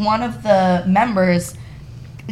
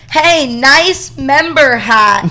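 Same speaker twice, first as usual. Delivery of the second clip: slow, drawn-out call across the room; caddy calling out to embarrass her, drawn out; mock scream; 4 semitones higher, 5 dB louder, much slower than usual. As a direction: call it out slowly so everyone hears. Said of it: "screaming so that people can hear".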